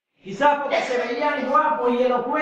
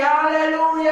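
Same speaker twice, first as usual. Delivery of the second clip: forced hoarse shout; 4 semitones higher, slower than usual. Retold or imitated. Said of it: "para que mi voz se haga ronco".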